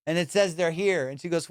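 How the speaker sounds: ordinary talk, nothing marked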